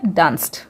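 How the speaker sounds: डांस्ड pronounced "danst"; final D as T. Shown as a demonstration. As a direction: finish डांस्ड on s plus voiceless t. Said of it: "डी का sound ट होगा". (डांस्ड को डांस्ट बोलकर)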